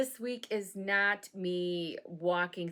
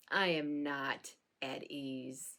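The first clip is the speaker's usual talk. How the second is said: slow, quieter dry delivery; deadpan quote; 6 semitones lower, 5 dB quieter, slower than usual. Shown as a demonstration. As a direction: say it flatly and slowly. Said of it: "be like Job and just say".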